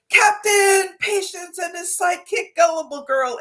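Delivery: high, grand and excited, like a cartoon announcer; hyped-up superhero intro. Shown as a demonstration. Telling her story audience about dad, excited superhero intro. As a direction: announce the heroes with big excitement. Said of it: "to elevate it a little bit".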